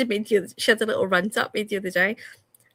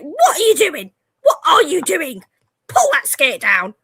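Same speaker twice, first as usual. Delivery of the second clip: loud, high, repeated scolding; exasperated mum; 7 semitones higher, 9 dB louder than usual. Retold or imitated. Mum catching daughter with skirt rolled up, loud scolding. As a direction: snap at her like a fed-up mum. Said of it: "and I'm like".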